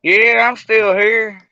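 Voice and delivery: speaking up slowly into radio; loud, drawn out radio talk. Real, not acted. Talking loudly into radio.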